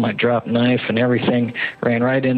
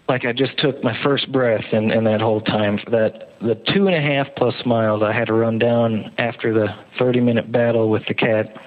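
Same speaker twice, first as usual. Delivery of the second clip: ordinary talk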